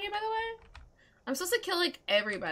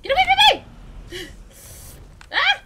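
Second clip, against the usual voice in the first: much higher, much louder shriek; panicked scream; 13 semitones higher, 8 dB louder than usual. Real, not acted. Player shrieking at an attacking dragon, real panic.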